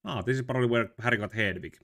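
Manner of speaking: ordinary delivery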